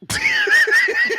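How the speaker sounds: high, helpless burst of laughter; laughing hard. Real, not acted. Co-host reacting to "what is a walk-around snack", high helpless laugh. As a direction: crack up at the question. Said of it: "That's why I'm laughing".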